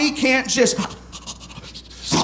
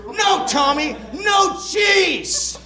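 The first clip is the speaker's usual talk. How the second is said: high, drawn-out telling-off of a dog; mock scolding; 8 semitones higher, much slower than usual. Reading the book's ban on cheese to Tommy, mock scolding. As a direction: scold the pug in a raised pitch.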